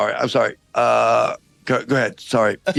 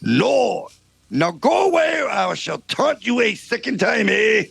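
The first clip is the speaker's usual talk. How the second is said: put-on French accent. French guard taunting King Arthur's knights, hammed-up French accent. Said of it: "this outrageous accent".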